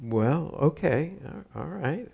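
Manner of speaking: put-on a nervous boy saying okay; a boy's unsure, nervous reply. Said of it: "I kinda nervously said".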